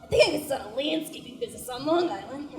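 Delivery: lightly voiced Long Island dad; a hint of a gruff Long Island dad. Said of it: "and he was like".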